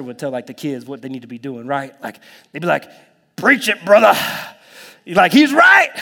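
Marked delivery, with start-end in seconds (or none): 3.4-4.2 loud, raised call of encouragement; imitated church holler; congregation's amen shout. Imitating approving older church members. 5.3-5.9 high, loud shout of approval; imitated congregation holler; shouted agreement. Imitating approving church members.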